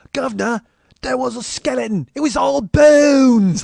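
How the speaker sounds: high, drawn-out hammy Cockney; old radio Cockney, swelling to a loud wail; overselling Cockney. Retold or imitated. Cockney radio actor reaching the back of the house, hammed-up. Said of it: "there was a Cockney guy in it, and he, he was overselling everything".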